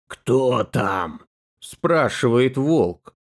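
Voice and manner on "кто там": low gruff wolf voice; hoarse, growly wolf; rough hoarse voice. Acted. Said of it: "А голос у него грубый, хриплый".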